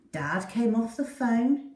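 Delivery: ordinary delivery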